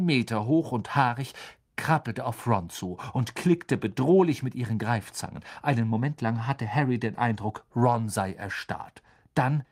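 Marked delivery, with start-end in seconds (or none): none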